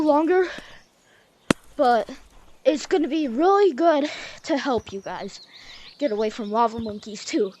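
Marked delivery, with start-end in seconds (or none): none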